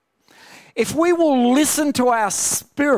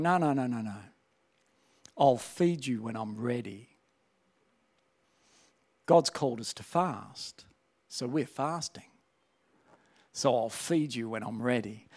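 calm, authoritative spirit; 9 semitones lower, 10 dB quieter than usual. Retold or imitated. His spirit refusing the flesh's demands, low calm firmness. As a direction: lower and softer, unhurried firmness. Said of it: "My spirit's going".

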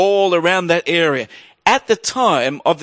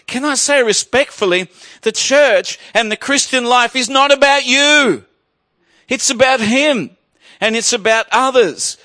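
fervent preaching; 5 semitones higher than usual. Acted. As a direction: preach it out to the whole church. Preaching rises to full force.

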